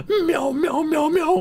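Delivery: very high mock cat meowing; cat meows. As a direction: meow like a silly little cat. Cat jokes, playing Sir Meows.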